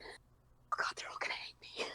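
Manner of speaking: soft, unvoiced, near-tearful whisper; fearful whisper. Recalling her panic before fans heard her, tearful whisper. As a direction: whisper it in dread, almost crying. Frantic fear at premiere.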